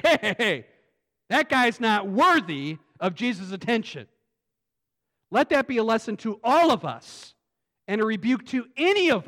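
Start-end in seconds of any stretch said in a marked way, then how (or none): 0.0-4.1 a loud mock protest from the crowd; grumbling Pharisee. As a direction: voice the offended religious crowd. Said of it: "religious people that say".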